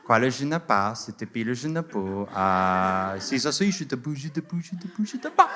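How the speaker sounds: mock French patter climbing at the end; fake French. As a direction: rattle off nonsense as if fluent French. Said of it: "It's speaking fake French".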